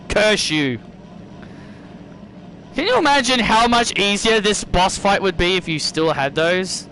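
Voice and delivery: ordinary talk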